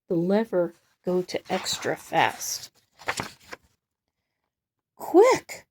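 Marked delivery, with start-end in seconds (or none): 5.0-5.7 high, loud urgent call; yelled order. Acted. Chocolate worker shouting across the factory, loud and high. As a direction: shout for boxes in a hurry. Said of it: "yelled a man".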